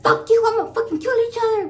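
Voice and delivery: high, loud, fast mock fury; psycho rage. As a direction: snap into hormonal rage, rushing. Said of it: "I got fucking psycho".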